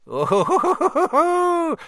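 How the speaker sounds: a very high, loud, gleeful squeal; cackling poltergeist. A narrator's reading. Peeves delighting over the first years, high cackling squeal. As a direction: swoop up high with mischief. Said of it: "with an evil cackle".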